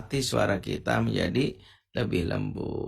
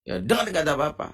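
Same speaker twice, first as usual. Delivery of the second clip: mock scolding; 4 semitones higher than usual. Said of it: "kita bilang".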